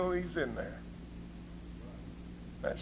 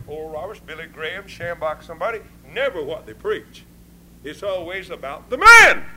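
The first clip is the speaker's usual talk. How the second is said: usual speaking voice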